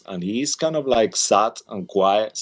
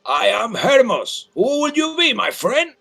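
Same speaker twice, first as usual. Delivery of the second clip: high, loud barker's call; much higher and louder barker voice; loud carnival barker; 5 dB louder than usual. Acted. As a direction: bellow it like a carnival barker working the crowd. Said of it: "That's why I speak so loud".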